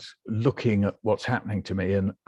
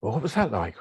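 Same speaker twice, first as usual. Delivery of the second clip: ordinary talk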